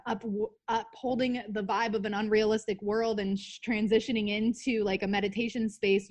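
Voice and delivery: usual speaking voice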